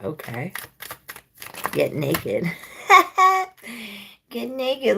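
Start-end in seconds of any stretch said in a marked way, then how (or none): 2.5-4.0 loud high giggle; squealing laugh; high burst of laughter. Real, not acted. Laughs at own joke. 4.3-5.0 "Get naked" much higher, cheeky and teasing; high, cheeky, playful; playful tease. Real, not acted. Repeated with playful lift.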